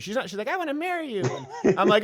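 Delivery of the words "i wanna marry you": very high put-on little girl voice; mock childish declaration in falsetto; imitated lovestruck girl. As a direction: squeak it like a smitten child. Imitates friend's young daughter with crush.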